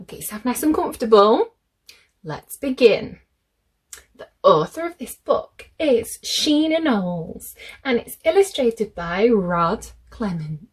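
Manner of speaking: plain reading voice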